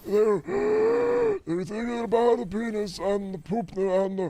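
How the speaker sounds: high, slow, gasping politician parody; Trump. Acted. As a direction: drag the words out high and suck in air. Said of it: "should have been more accurate".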